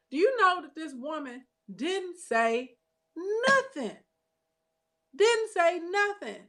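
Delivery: can you believe she said nothing; incredulous outrage. Real, not acted.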